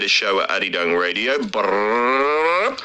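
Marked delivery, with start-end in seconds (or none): 1.4-2.8 badly faked rolled-tongue drum roll; mouth drum roll. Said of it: "my drum roll was terrible".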